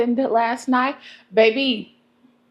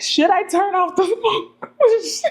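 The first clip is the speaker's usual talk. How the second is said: laughing question; 8 semitones higher, slower than usual. Retold or imitated. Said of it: "I said".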